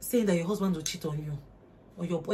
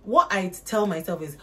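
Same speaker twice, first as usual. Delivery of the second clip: ordinary delivery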